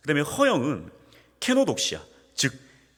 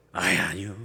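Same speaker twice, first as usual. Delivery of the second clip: polite refusal; 6 semitones lower, 3 dB quieter than usual. Retold or imitated. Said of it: "이게 충청도 스타일이라는 거예요".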